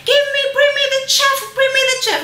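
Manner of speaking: very high, fast mock demand; demanding passenger. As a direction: shriek for the chef like an entitled customer. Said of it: "And she was like".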